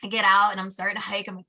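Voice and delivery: normal talking voice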